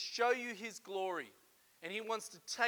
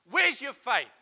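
raised, rousing voice of God calling out; God urging; 7 semitones higher, 8 dB louder than usual. Acted. God calling the listener to lean in, loud and rousing. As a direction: urge them loudly to step in. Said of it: "He put it on your heart to say".

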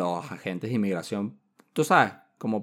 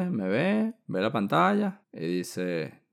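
ordinary talk, nothing marked

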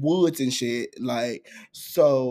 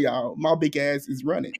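usual speaking voice

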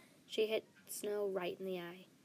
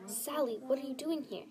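mildly worried, higher-pitched question; 5 semitones higher, 4 dB louder than usual. A narrator's reading. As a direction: voice a touch of alarm. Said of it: "with a worried tone in her voice".

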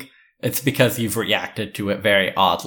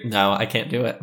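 usual speaking voice

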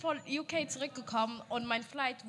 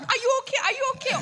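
raised, louder voice of a worried stranger; alarmed passer-by; 9 semitones higher, 9 dB louder, faster than usual. Retold or imitated. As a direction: imitate someone urgently checking on a fallen person. Acting concerned stranger after her fall.

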